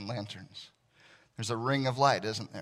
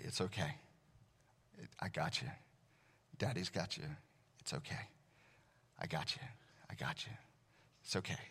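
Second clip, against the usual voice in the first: put-on soft, hushed comforting to a child; soothing a child; 5 semitones lower, 11 dB quieter than usual. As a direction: murmur it close into her ear. Dad soothing daughter's night terror.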